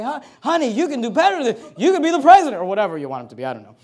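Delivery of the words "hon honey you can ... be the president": raised-pitch wifely pep talk, building louder; acted encouragement, swelling on the last phrase; encouraging. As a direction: cheer a husband on. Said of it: "encourage him. Say".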